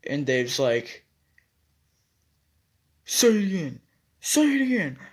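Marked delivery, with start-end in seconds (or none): 3.1-3.8 raised, higher challenge; demanding challenge. Retold or imitated. Dave challenges narrator to repeat. 4.2-5.0 escalating challenge. Retold or imitated. Dave repeats challenge, escalating.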